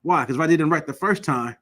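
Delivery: normal talking voice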